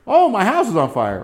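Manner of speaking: a hammed-up cry that the house burns; a touch of mock panic.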